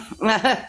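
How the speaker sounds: short uneasy chuckle; nervous laugh. Real, not acted. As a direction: laugh it off, caught out. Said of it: "that's why you're doing that nervous laugh".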